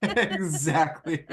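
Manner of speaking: slow laugh through the word; agreeing through laughter with Lisa's joke. Real, not acted.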